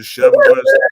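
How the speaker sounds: high, loud, fast shouted order; loud fast yell. Retold or imitated. Repeating order, shouting at wife.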